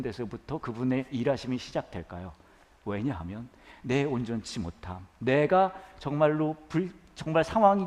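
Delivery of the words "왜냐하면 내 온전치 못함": ordinary delivery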